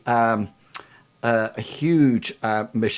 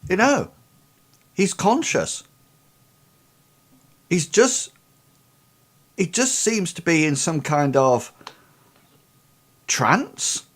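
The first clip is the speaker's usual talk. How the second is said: higher, puzzled medic; a hint of a higher character voice, thinking it through; lightly voiced medic; 4 semitones higher than usual. Game master plays Ida.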